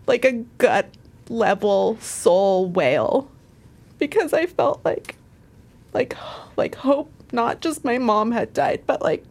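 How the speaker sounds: high voice, close to sobbing; real tearful voice. Real, not acted. Woman recalling how her hope died with mom, real crying. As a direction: push the words out through grief. Still crying over mother's death.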